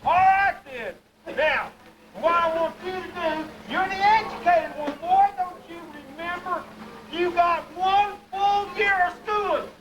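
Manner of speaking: Pa bragging about his educated son; boasting hillbilly Pa. Acted. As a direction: brag about the boy's one year of school.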